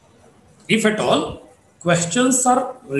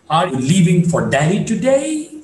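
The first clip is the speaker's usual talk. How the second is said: "today" climbing at the end; rising tone. Shown as a demonstration. Teacher gives another example, rising tone. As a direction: lift the question on "today". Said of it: "is to be marked with the rising tone".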